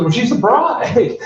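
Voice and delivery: high, slow as-if-obvious; woman explaining to a clueless guest who the bride is; matter-of-fact. Retold or imitated. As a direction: spell it out for the confused man. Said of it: "And she goes".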